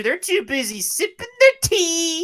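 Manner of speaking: high mock British voice; mock British accent. Mocking the British in the game, high mock British accent. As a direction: send up a tea-sipping Brit in a high accent.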